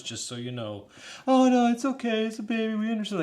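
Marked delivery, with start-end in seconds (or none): 1.3-3.2 high, louder sing-song mock of polite strangers; hammed-up cheerful reassurance; mock reassuring passengers. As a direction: parody the breezy passengers. Mimicking reassuring fellow passengers.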